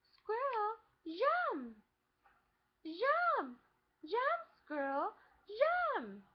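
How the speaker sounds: put-on loud, high shout in a Spanish accent, "jump" as "zhum"; Dora's Spanish-accented English. Dora shouts to the squirrel, high and loud in a Spanish accent. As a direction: mimic a cartoon girl's accented English, calling out. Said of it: "this girl's trying to say jump".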